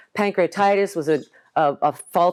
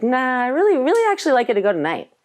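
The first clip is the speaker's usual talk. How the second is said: higher, louder, firm doctor voice; insistent doctor; 8 semitones higher, 3 dB louder, faster than usual. Retold or imitated. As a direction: push the patient kindly but firmly.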